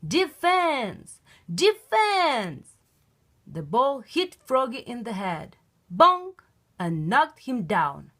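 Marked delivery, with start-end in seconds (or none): 0.1-2.8 high yelled call, repeated; yelling. Acted. Dad yelling to the team from the sideline, loud. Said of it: "Froggy's dad was yelling". 5.9-6.4 high, loud comic bonk; bonk sound. Acted. Ball hitting Froggy's head, comic high bonk.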